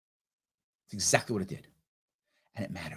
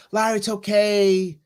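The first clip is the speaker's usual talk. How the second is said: mock cheer-up friend; 8 semitones higher, 5 dB louder than usual. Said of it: "She goes".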